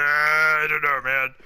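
ordinary delivery

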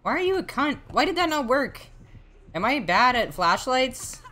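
frustrated gamer whining at the killer; frustrated squeal. Real, not acted. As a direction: shriek the complaint.

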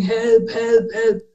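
high-pitched mock cry for help; retold scream for help. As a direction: re-enact screaming for help, not at full volume. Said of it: "I'm screaming".

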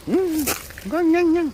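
high squeaky nom-nom; playful munching noises. Pretend. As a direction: imitate a tiny animal munching, high. Mimics puppy munching on food.

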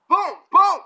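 loud, high imitated impacts; punch sound effects. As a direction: punch out each noise like a landed blow.

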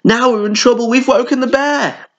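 high, worried wail; panicked animals. A narrator's reading. Animals realising they woke the bear: high worried wail.

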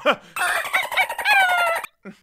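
high squeaky dolphin chirp; dolphin squeal. Retold or imitated. Showing viewers a dolphin impression, high chirping squeal. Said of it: "my closest dolphin impression".

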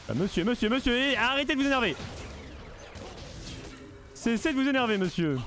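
high, fast, hammed-up polite calming; mock soothing. Streamer mockingly calming an angry opponent, high and hammed-up.

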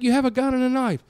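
very high, loud and fast; exasperated yell. Retold or imitated. Father scolds his daughter, incredulous yell. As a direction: blurt it out incredulous. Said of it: "I was like".

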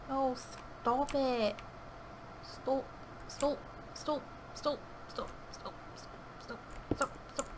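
soft, high whimpered stops; whimpering plea. Real, not acted. Gamer whimpering at the attacker to stop, soft panicked pleading.

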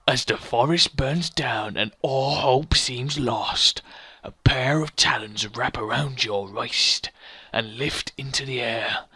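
slow, low epic storytelling; solemn, drawn-out dramatic reading; awesome narrator voice. Acted. Player reading the ending to his brother, epic narrator. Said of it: "my awesome voice".

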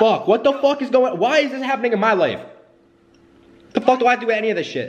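high, fast furious yelling; angry outburst. Real, not acted. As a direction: lose it at the whole situation. Real fight over the car.

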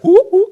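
high, loud whooping; two big high whoops; charismatic whoop. Shown as a demonstration. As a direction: whoop it up at the top of your voice.

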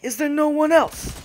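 high, loud mock-heroic hero voice; mock Achilles. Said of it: "he's like Achilles right now".

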